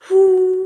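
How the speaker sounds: high loud whoo of air; sung breath demo. Shown as a demonstration. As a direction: push the air out loudly on whoo. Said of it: "a little bit more instead of just".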